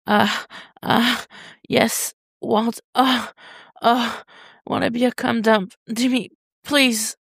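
breathy high gasping begs, slowing at the end; whimpering plea. A narrator's reading. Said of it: "Tim whimpers and nods".

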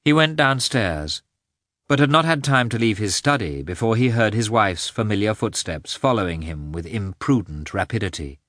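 usual speaking voice

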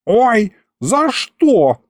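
high, slow, drawn-out ouch; pained yelp. Acted. Struck imp wails high and slow. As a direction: wail as if struck.

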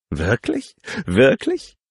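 playful rising squeal; playful teasing. Acted. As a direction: tease him again, voice jumping up. Teasing him again.

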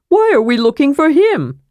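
high-pitched incredulous question; astonished. A narrator's reading. Kender questioning dwarf's plan to find Armin, high and incredulous. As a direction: kender voice shooting up in disbelief.

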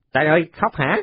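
put-on high, cheeky kid's voice; a scrappy boy poking fun; teasing boy. Còi teases the new boy for crying, high and cheeky.

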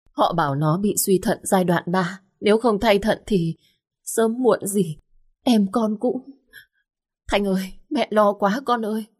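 a mother near tears over her son in hospital; mother breaking down on the phone to her daughter; sobbing mother. Acted. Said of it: "bật khóc nức nở".

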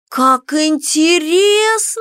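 slow drawn-out high voice. A narrator's reading. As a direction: stretch every syllable out. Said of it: "протянула Кико".

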